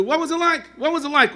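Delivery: high, loud, excited repeated question; a nosy listener pressing the survivor; eager questioner. Retold or imitated. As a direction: pester her with eager curiosity. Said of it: "So they asked her".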